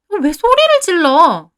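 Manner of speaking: high, loud, drawn-out protest; indignant surprise. A narrator's reading. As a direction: react offended at being yelled at. Startled reply to being yelled at.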